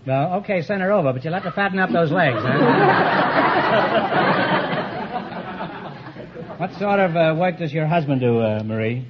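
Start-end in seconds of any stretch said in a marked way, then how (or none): 2.6-6.5 a long chuckle after the punchline; laughing. Real, not acted. Quiz host laughing after telling the salesman to fatten the legs. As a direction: laugh at your own gag.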